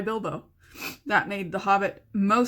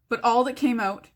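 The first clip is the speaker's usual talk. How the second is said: ordinary delivery, nothing marked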